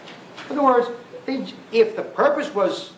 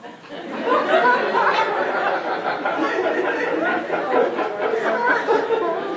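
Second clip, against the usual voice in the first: scornful laugh. Real, not acted. Laughs at the caller.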